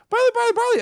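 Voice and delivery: high, loud calling out a name; excited fans calling. Retold or imitated. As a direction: call out eagerly like fans spotting a star.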